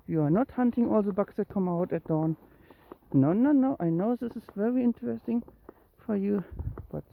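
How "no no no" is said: higher, louder string of noes; head off a pet's mischief; quick refusal. Real, not acted. Owner stopping the cat's bug hunt, higher urgent refusal. Stopping the cat hunting bugs.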